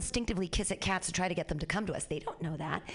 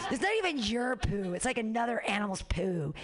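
ordinary talk, nothing marked

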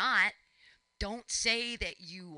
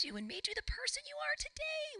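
mock reverent whisper; 4 dB quieter than usual. Host gushing over guest's cult story.

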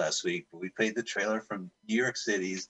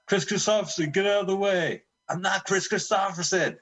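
high mock yell; 9 semitones higher than usual. Yelling at movie extras.

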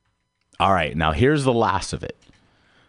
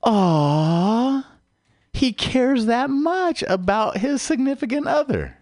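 much higher, cooing impression of a charmed female cashier; gushing female checker; 14 semitones higher, slower than usual. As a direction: coo it like a touched shop clerk. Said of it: "they're gonna go".

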